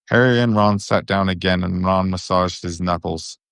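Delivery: ordinary delivery, nothing marked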